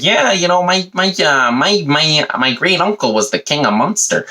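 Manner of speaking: hesitant American visitor impression; American tourist. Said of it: "American people coming over and being like".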